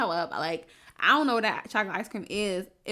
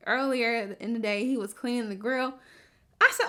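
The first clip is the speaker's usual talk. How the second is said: ordinary talk, nothing marked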